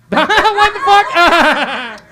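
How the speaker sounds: high, loud, incredulous cackle; laughing disbelief. Real, not acted. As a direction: shout it through a big laugh. Audience member answers no, he cracks up.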